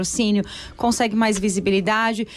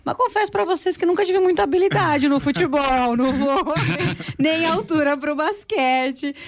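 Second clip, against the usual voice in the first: higher-pitched, laughing self-mockery; amused confession; 6 semitones higher than usual. Real, not acted. Host confessing to listeners her lack of sporting skill, amused and laughing. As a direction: confess it lightly, breaking into laughter.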